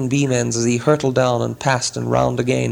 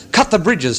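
urgent order; 6 semitones higher than usual. Acted. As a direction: command the townsfolk at once. Alarm call to the town.

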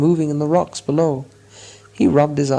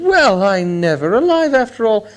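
delighted; 5 semitones higher than usual. A narrator's reading. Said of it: "he was delighted".

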